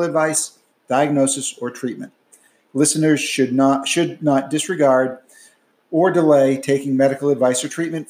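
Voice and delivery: brisk, clear announcer read of the disclaimer; podcast outro read. Acted. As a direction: read it like a formal podcast sign-off.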